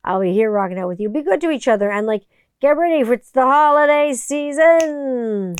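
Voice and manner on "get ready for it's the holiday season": higher, louder, slow hyped sign-off; excited sing-song. Real, not acted. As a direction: draw it out like a cheer for the holidays.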